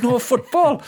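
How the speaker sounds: mock-innocent high protest; high retorting voice. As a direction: squeak it out high and indignant. His own retold answer.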